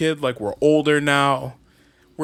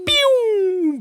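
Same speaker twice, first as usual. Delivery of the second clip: very high "pew" rocket whistle; rocket noise; 4 dB louder than usual. Acted. Answering a rocket ship joke, imitated launch noise.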